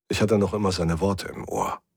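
plain reading voice

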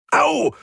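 sharp high yelp of pain. A narrator's reading. Mechanic hurts his fist on metal, pained yelp. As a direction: cry out at a sudden burn. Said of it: "diesmal allerdings vor Schmerz".

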